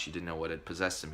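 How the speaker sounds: normal talking voice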